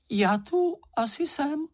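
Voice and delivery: very high little voice; tiny shadow's voice. A narrator's reading. Smallest shadow answers after the storm, tiny high voice. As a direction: squeak it out like the smallest creature. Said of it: "odpověděl nejmenší stín".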